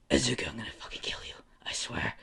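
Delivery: unvoiced, fast seething whisper; whispered threat. Stuck bully swearing revenge on his rival, whispering angrily. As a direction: rush the threat out under your breath.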